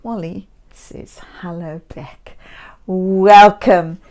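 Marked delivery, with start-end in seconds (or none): none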